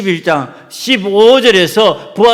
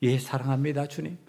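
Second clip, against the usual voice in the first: soft first answer; 3 semitones lower, 11 dB quieter than usual. Acted. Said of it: "세 번 질문을 합니다".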